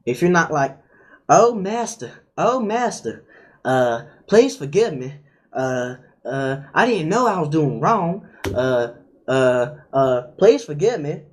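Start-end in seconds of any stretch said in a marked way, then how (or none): 1.3-11.2 mock servile begging, pitch lifting on the cries; pleading slave. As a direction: beg like a slave before a master. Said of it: "like you're a slave".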